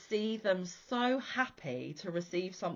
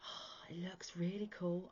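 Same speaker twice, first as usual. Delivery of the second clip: whispered son; 7 dB quieter than usual. Retold or imitated. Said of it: "he was like".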